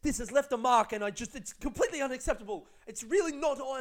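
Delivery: high, loud indignant complaint; irate customer. Retold or imitated. Moaning customer complains about the delay, mock outrage.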